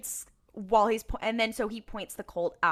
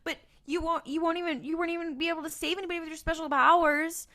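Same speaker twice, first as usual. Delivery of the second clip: high, taunting sneer; mocking demon; 8 semitones higher, faster than usual. Demon taunting Sam about his powers, high mocking sneer. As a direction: jeer at him like a gloating demon. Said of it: "he starts mocking Sam".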